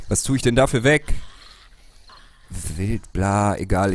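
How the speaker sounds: usual speaking voice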